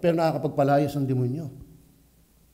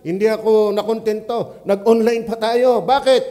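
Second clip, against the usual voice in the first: louder, higher impassioned sermon delivery; fervent preaching; 8 semitones higher, 7 dB louder than usual. Acted. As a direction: drive it home to the congregation. Preaches at full force.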